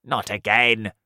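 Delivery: loud, fed-up sigh of words; exasperated. A narrator's reading. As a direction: groan it out. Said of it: "the earthworm said".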